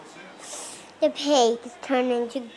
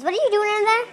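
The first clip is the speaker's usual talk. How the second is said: usual speaking voice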